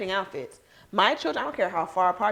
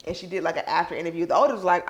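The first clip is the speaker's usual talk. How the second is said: ordinary talk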